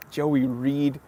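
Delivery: ordinary talk, nothing marked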